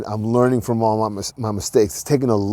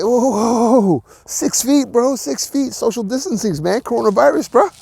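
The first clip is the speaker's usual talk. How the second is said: alarmed whoa; 10 semitones higher than usual. Retold or imitated. Backing off from a hug.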